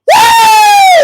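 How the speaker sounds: very high, loud laughing shriek; ecstatic shriek-laugh. Real, not acted. Preacher overcome with joy laughing. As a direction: let out a high laughing whoop.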